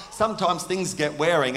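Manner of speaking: ordinary talk, nothing marked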